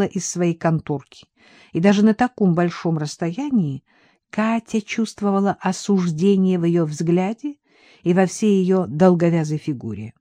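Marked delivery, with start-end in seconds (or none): none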